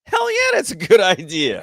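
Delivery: loud, high-pitched retold eagerness; gung-ho enthusiasm. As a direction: fire back with comic overconfidence. Retells his eager reply to wife.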